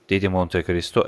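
ordinary delivery, nothing marked

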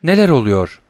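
plain reading voice

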